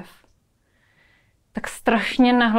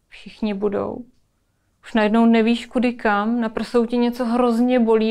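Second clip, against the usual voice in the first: usual speaking voice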